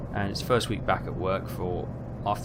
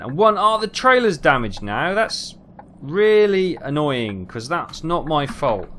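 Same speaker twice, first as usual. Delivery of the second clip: higher, louder, slow annoyed protest; 5 semitones higher, 6 dB louder, slower than usual. Real, not acted. Driver protesting his damaged trailer, annoyed. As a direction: complain that it isn't fair.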